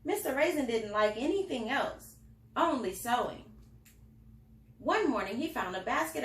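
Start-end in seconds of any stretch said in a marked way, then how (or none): none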